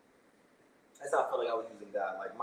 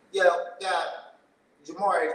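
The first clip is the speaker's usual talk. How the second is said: raised call, higher and louder; 4 semitones higher, 5 dB louder, slower than usual. Retold or imitated. Calling out to God at the door, raised voice. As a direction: shout up to get God's attention.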